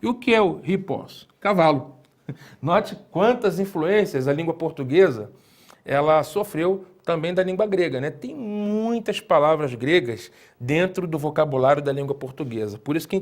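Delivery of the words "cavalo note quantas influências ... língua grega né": ordinary talk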